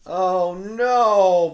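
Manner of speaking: high, loud dismayed moan; moaning volunteer. Acted. Volunteer seeing the mess George made, loud dismayed moan. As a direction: wail at the mess. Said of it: "moaned the volunteer".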